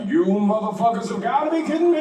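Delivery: exasperated civil-rights leader. Acted. Comedian imagining Martin Luther King's reaction, exasperated. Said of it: "don't you feel like he'd be like".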